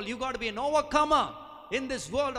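loud, high rallying call; rally the congregation; loud rising call; preaching shout. Real, not acted. Preaching climax.

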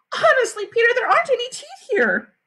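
high, insistent whine; exasperated girl. A narrator's reading. Sheila protesting to Peter while searching, high exasperated voice.

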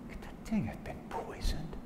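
hushed, near-whispered wondering; worried thought. Acted. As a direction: think it aloud very softly, uneasy. Sick wolf's worried private thought.

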